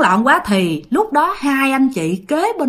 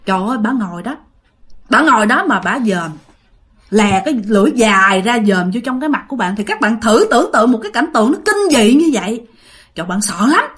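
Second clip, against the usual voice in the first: plain reading voice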